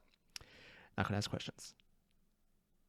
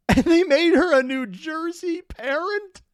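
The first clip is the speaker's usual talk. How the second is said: laughing disbelief; 13 semitones higher, 9 dB louder, slower than usual. Real, not acted. Laughing disbelief at the recast mom.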